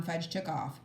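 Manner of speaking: ordinary delivery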